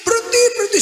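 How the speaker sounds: high, urgent calling out; imitated villagers' shouting. Villagers yelling at motorbike rider to stop, imitated shouting. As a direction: stretch the first word into a high warning cry. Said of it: "Penduduk pada teriak".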